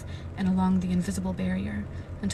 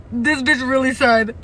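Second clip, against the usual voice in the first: outraged; 5 semitones higher, 8 dB louder than usual. Real, not acted. Outraged reaction to reading.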